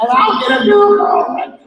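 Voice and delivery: high and loud, thrilled; excited. Acted. Bob dreaming of a girlfriend, excited high cry. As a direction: proclaim it with glee.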